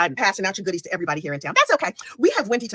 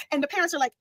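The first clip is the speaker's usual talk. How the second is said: ordinary talk, nothing marked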